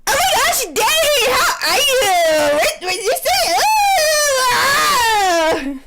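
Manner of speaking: mock the reading as a giddy fangirl; squealing fangirl. Narrator mocking friend's reading, a high giddy squeal. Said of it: "Drew is all sarcastic and deadpan".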